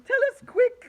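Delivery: high loud urgent plea; urgent cry. A narrator's reading. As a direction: hurry him along. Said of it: "cried Earthworm".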